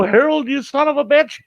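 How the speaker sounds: high-pitched outraged shout; indignant high holler; angry yell. Acted. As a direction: curse out a friend who got you, pitch shooting up. Yells at friend who hit him.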